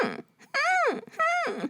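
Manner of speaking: very high, soft whimpers; squeaky puppy whimper. Shown as a demonstration. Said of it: "or higher if your voice is higher".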